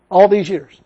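ordinary delivery

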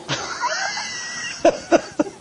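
breathy high laugh at his own joke; airy laugh. Real, not acted. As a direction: let out a quiet airy laugh.

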